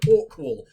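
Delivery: "awkward" said as "awk-wal", loud; quoted mispronunciation. Retold or imitated. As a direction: repeat the slip, "awkward" ending in -wal. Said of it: "because I just said".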